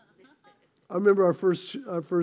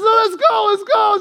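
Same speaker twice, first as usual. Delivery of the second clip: quick, loud and higher, rushing her; frantic husband at three in the morning; panicked urging; 7 semitones higher, 5 dB louder than usual. Retold or imitated. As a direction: hurry her out of bed in a panic. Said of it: "I said".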